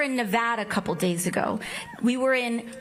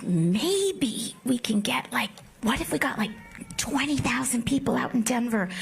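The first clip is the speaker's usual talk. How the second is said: whisper; 2 semitones lower than usual. Retold or imitated. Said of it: "we were like".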